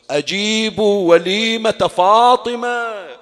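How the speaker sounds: slow, loud and high public call. Retold or imitated. Imam Ali calling Medina to Fatima's wedding feast, loud drawn-out cry. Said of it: "وضع سبابتيه في أذنيه وصاح".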